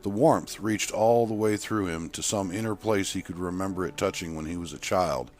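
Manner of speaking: plain reading voice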